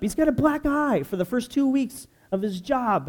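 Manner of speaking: usual speaking voice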